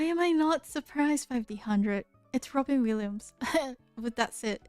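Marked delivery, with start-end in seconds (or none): none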